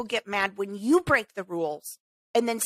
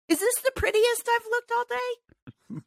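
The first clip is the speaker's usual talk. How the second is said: high, put-on mock question; sarcastic aside; 11 semitones higher than usual. Real, not acted. Checking her look mid-rant, high sarcastic lilt. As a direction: tease herself in a lilting voice.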